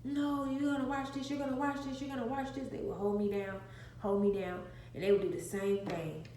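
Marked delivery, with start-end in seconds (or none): 0.0-2.7 higher-pitched imitation of insistent siblings; mock insistence. Siblings forcing little sister to watch, mock insistence.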